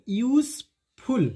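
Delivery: "यूजफुल" said slowly as yoos-ful, with an s; correct pronunciation. Shown as a demonstration. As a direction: model "यूजफुल" slowly with a hissed s. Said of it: "यूज नहीं".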